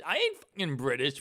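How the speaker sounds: ordinary delivery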